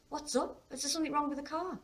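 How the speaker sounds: high, quick questioning; worried teenage daughter. A narrator's reading. Daughter worried about the stop.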